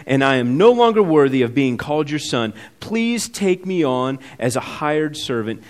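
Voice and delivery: loud, pleading son begging to come home; desperate plea. Acted. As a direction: beg like a starving son returning. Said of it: "Can you hear the desperation in this young man's voice?".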